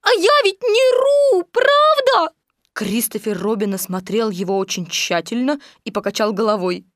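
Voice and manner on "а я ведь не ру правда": sobbing. A narrator's reading. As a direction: plead your case in a squeaky wail. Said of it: "рыдал Пятачок". (жалобный плаксивый писк)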